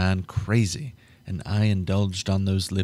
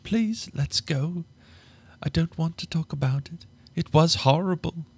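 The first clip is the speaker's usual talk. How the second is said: tearful plea; 10 semitones higher than usual. Acted. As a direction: beg through tears to leave. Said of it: "she uttered among the sighs".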